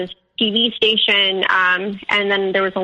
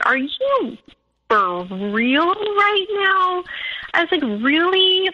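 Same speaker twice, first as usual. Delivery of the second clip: high, drawn-out disbelief; incredulous disbelief; 9 semitones higher, slower than usual. Retold or imitated. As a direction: throw his accusation back, stunned. She answers his accusations, incredulous.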